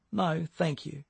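soft, wobbling, near tears. A narrator's reading. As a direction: hold back tears, voice wobbling. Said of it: "her voice wobbling".

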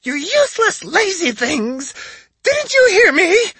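high, loud scolding screech; exasperated witch. A narrator's reading. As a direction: berate the useless beasts. Witch berates silent familiars.

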